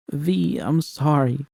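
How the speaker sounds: slow, small high apology; fragile and close to tears. Acted. Narrator apologizes to V, slow and fragile.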